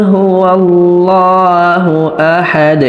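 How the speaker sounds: slow melodic recitation; recited slowly. Shown as a demonstration. Teacher modelling the verse for students, slow chant. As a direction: draw out قل هو الله like tilawa.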